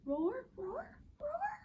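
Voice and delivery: soft, high, tiptoeing roars; tiny hushed dinosaur; sneaky little roars. Acted. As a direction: roar as if trying not to be heard.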